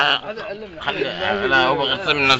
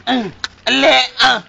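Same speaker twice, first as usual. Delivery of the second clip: high playful cry; 7 semitones higher, 7 dB louder, faster than usual. Acted. Chant game, high playful cry.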